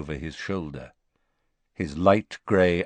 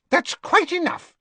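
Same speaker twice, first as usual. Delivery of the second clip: much higher, sharp rebuke; sharp Madame Malkin; 3 dB louder than usual. Acted. Madame Malkin sharply stopping the quarrel, much higher. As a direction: snap it like a flustered shopkeeper. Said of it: "said Madame Malkin sharply".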